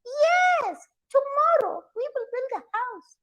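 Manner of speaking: very high, singsong mother monkey. Acted. Mother monkey echoing father monkey, high-pitched agreement. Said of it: "The mother monkeys would shake their head and say".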